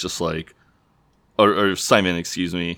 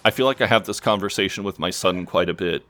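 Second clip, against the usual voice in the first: normal talking voice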